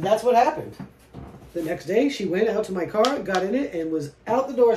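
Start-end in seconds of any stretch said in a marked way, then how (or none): none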